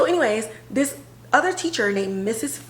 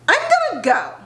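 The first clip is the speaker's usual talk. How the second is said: awkward exit; 13 semitones higher, 4 dB louder, faster than usual. Retold or imitated. Maggie leaving awkwardly.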